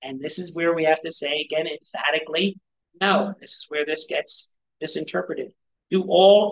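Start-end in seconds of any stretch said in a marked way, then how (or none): none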